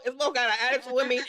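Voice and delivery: ordinary talk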